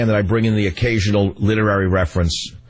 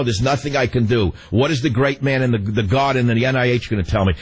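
ordinary talk, nothing marked

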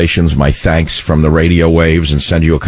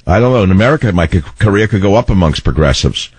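usual speaking voice

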